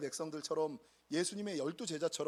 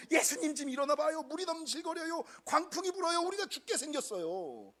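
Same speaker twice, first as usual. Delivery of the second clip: mock frantic plea; 8 semitones higher than usual. Disciples frantically waking Jesus.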